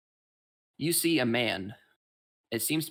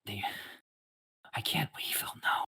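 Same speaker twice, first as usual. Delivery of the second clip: a trembling whisper, fading to breath; a scared, half-mad old man whispering his fear; whispering in terror; frightened hermit; 6 semitones higher than usual. Acted. GM voices shivering hermit.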